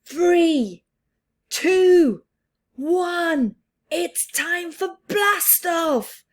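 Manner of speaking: high, loud launch countdown; excited countdown. Acted. Reader counting down the rocket launch, high and loud. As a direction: build the thrill of liftoff, high and loud.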